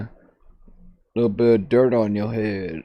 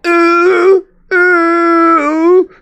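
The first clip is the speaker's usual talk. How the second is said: mock whimper; 7 dB louder than usual. Whimpering for someone's hand.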